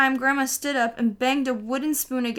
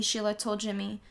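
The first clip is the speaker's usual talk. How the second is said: ordinary delivery, nothing marked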